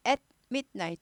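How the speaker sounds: At midnight cut twice: at, mit, night; two breaks. Shown as a demonstration. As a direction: stop after At, then again inside midnight. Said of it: "두 번 끊잖아요".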